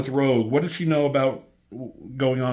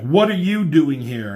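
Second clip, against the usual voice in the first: louder, a little higher, like an angry demand; 4 semitones higher, 8 dB louder than usual. Acted. Gambit confronting a figure from the darkness, mildly angry. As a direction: snap the question at the stranger. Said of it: "he gets mad".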